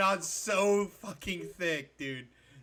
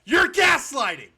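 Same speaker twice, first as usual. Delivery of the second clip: high, loud outburst of accusation; exasperated yelling; 11 semitones higher, 13 dB louder than usual. Real, not acted. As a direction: yell it to force the point across. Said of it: "you're screaming at me right now".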